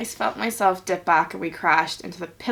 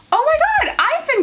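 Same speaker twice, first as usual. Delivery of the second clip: squeaky high shocked gasp; sudden disbelief, way up high; 5 dB louder, faster than usual. Real, not acted. Shock at being gone a year.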